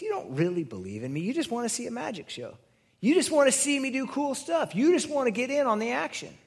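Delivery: Jesus calling out a show-seeker; challenging Jesus. Retold or imitated. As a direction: start level, then push it up high. Jesus voiced in the retelling.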